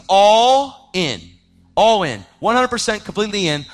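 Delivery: preaching emphasis. Real, not acted. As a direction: drive home all in like a preacher. Preaching submission to God.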